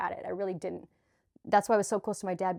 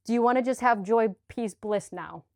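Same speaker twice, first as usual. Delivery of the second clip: ordinary talk, nothing marked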